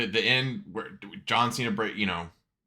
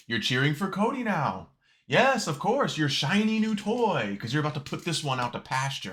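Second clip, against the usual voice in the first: mock taunting; 7 semitones higher than usual. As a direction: taunt the crowd as a scorned wrestler. Voices Cena taunting the crowd.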